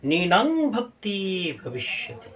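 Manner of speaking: slow, measured Sanskrit verse chant; শ্লোক পাঠের সুরে ধীরে; scripture recitation. Acted. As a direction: intone the verse slowly like scripture. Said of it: "বলছে".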